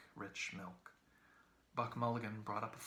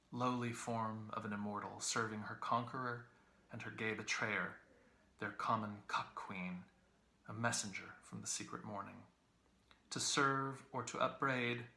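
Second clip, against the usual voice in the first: ordinary delivery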